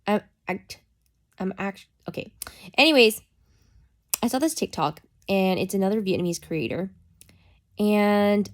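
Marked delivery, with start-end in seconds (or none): none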